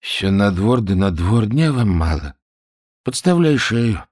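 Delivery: mildly grumpy, sleepy complaint; grumbling. A narrator's reading. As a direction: grumble reluctantly at a bothersome prisoner. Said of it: "проворчал".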